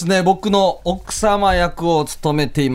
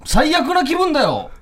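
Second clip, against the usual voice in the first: angry husband; 6 semitones higher than usual. Acted. Husband confronts wife in skit.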